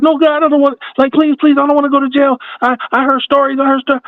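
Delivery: mock-frantic kids pleading, pitched high; panicked begging. The kids plead with the police, hammed-up high panic. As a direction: beg like a scared kid facing jail.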